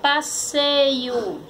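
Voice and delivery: "passeio" as one slow whole word; palavra juntada. Shown as a demonstration. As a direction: join the syllables of "passeio", unhurried. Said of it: "Formou a palavra". ("passeio" devagar, palavra inteira)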